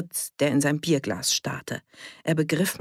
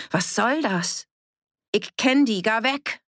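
higher, agitated warding-off; agitated rebuff; 7 semitones higher than usual. A narrator's reading. Annie fearfully holds the amulet against Edith, agitated. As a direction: push them away with a raised, urgent voice.

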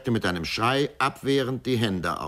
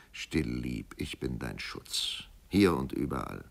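tender comfort; 7 semitones lower, 6 dB quieter than usual. Acted. Lover comforts her.

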